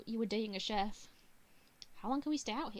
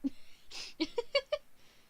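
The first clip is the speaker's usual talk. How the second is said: high, wheezy giggling; real giggle; 3 dB louder than usual. Real, not acted. Game player cracking up after fumbling a name, real giggle. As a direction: crack up helplessly. Cracks up at own tongue twister.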